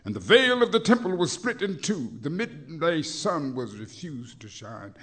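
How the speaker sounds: chanting sermon with swelling peaks; preached poem. Acted. Rhythmic preached poem throughout.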